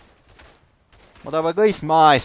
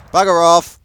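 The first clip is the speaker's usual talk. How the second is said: loud, higher bark of bugger off; annoyed shout; 5 semitones higher, 8 dB louder than usual. Real, not acted. Gamer yelling at an AI soldier to move, loud annoyed shout. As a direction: shove him off with a yell.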